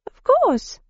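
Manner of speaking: put-on high girlish voice; young girl voice. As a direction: play a stubborn young girl.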